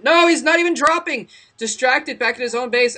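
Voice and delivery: sudden high, loud outburst; surprised shout. Real, not acted. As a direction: cry out in disbelief. Caster surprised drop didn't happen.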